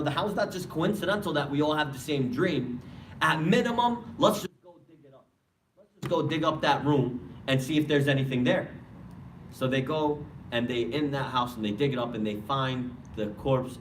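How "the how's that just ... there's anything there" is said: higher-pitched, insistent arguing; passionate younger brother. Acted. Younger brother pressing his older brother to go dig, passionate. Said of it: "they're very passionate".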